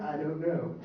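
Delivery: ordinary talk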